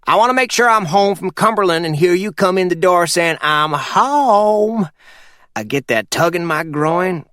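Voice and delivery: put-on a sly old mountain man giving orders; Purvis voiced. Narrator voices Purvis on phone.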